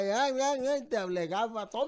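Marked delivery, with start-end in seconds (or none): 0.1-0.8 lightly voiced complaining parrot, higher; a hint of a fussy bird's cries; squawking bird imitation. Owner showing how his bird yelled, lightly imitated squawks. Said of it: "te gritaba".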